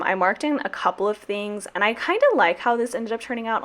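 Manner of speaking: ordinary talk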